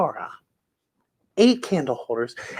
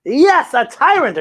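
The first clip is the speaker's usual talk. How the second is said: agreeing; 6 semitones higher, 5 dB louder, slower than usual. Acted. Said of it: "agrees Grandpa".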